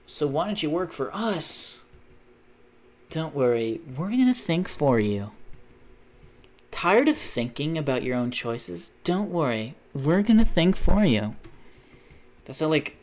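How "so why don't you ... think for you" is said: mock reassuring ad voice, building louder at the end; parody commercial pitch. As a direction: sell it like a sinister advert. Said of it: "how many commercials are actually like that now".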